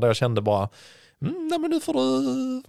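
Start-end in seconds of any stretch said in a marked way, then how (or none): 1.2-2.6 high, drawn-out mock impatience; impatient inner voice. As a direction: act out growing impatience, stretched and high. Acting out impatience before the race.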